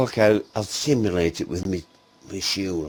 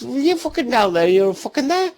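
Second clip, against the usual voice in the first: raised, high-pitched yell of a retold line; mock-exasperated calling out; retold shout; 8 dB louder than usual. Shouting up to mate below.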